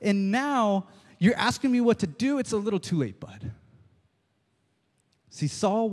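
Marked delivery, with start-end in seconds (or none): 0.0-3.5 much higher, scolding, fading at the end; scolding Samuel. Acted. Samuel scolding Saul for asking too late. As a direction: tell Saul off, then drop it. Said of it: "As Samuel is saying".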